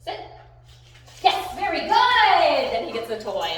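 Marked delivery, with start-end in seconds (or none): 1.2-2.8 high, loud, slow drawn-out praise; excited praise. Real, not acted. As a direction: celebrate the sit with a long whoop. Praising dog for sitting.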